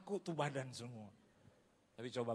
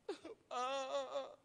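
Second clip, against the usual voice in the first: high imitated wail; hammed-up drawn-out sob; mock crying; 3 dB louder than usual. Preacher imitating a young man running to him, wailing.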